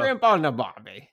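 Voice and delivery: put-on jokey Australian accent through laughter; mock Australian. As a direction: play up the Aussie cliché with a laugh. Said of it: "starting to sound Australian".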